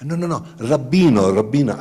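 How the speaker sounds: insistent mock Italian; fake Italian. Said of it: "I speak fake Italian".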